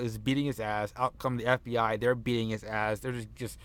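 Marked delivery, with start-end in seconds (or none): none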